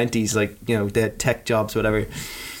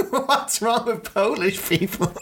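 mock offended; 9 semitones higher, slower than usual.

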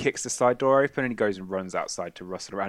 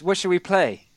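put-on lightly higher, louder eager child voice; eager creepy kid; 8 semitones higher, 6 dB louder, faster than usual. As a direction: play it as a gleeful kid looking for something to kill. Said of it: "he just goes".

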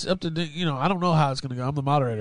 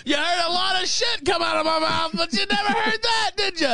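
teasing shout. Real, not acted. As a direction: crow it out to the chat, half laughing. Teasing boast to chat, laughing.

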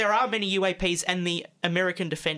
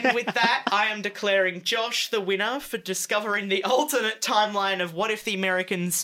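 game show host; 3 semitones higher than usual. Acted. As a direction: announce it to the room. Host declaring winner.

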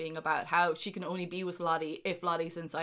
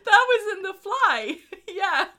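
laughing; 11 semitones higher, 10 dB louder than usual. Real, not acted. Laughing exclamation.

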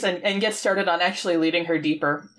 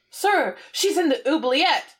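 high, slow goblin report; high-voiced mock goblin; goblin underling; 6 semitones higher, slower than usual. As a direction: imitate an anxious minion, pitched up. Voicing a goblin reporting to Jareth.

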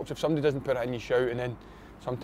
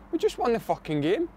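indignant comeback, voice pitched up; retold indignant retort; 9 semitones higher than usual. Player answering a teammate after missing a penalty, indignant. Retelling a dressing-room row.

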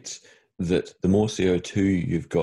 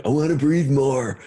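higher, slower mock whine; mocking small-jawed people; 7 semitones higher, 4 dB louder, slower than usual. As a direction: drag it out like a clueless mouth-breather. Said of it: "People with small jaws going".

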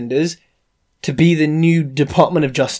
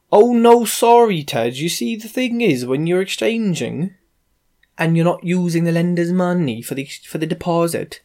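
bad Welsh accent; 3 semitones higher than usual. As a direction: put on a clumsy Welsh solicitor's accent. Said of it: "that Welsh accent".

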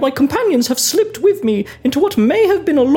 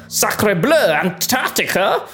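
put-on French accent; 8 semitones lower, slower than usual. Frenchman exclaims.

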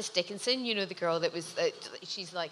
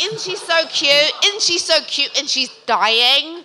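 much higher, louder, slow cooing; cooing supermodel; 7 semitones higher, 11 dB louder, slower than usual. Retold or imitated. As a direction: coo it over the head like an adoring fan. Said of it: "she's like".

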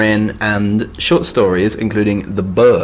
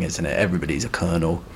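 normal talking voice

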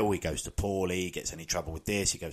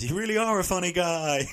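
much higher, louder imitation; exclaimed film quote; 13 semitones higher, 6 dB louder than usual. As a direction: pitch it high and loud, hamming up the quote. Acting Henry's line from the film.

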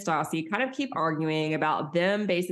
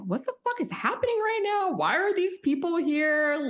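high, baffled mock outrage; mock shocked friends; 11 semitones higher than usual. Voicing Katie and Kristen arriving at the house, high mock shock. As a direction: squeal it like walking into chaos.

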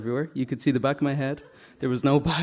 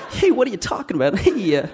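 lightly voiced, higher Spider-Man act; put-on Spider-Man; 5 semitones higher than usual. Spider-Man answering doubting kids, in a put-on high voice. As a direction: keep up the Spider-Man act when challenged.

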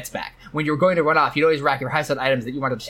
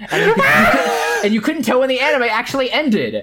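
much higher and louder, slowed by laughter; laughing; 10 semitones higher, 5 dB louder than usual. Real, not acted. Host recalling the commercial, laughing hard. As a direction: laugh your way through the words.

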